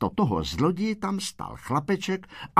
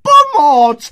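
very high, loud drawn-out alarm cry; screaming for help; 12 dB louder, slower than usual. A narrator's reading. Woman in the hallway about burglars, screaming for help. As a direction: scream to rouse the neighbours. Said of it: "vyběhla na chodbu a křičela".

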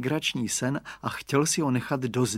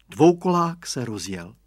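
ordinary delivery, nothing marked

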